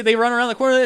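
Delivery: ordinary talk, nothing marked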